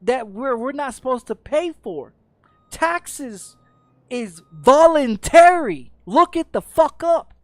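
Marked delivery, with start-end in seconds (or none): 2.7-7.3 loud, slow, furious insistence; ranting at viewers about taxes, a real angry shout. Real, not acted. Hammer it home, slow and loud.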